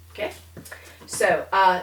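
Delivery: ordinary talk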